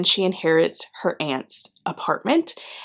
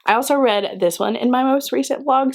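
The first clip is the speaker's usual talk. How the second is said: ordinary talk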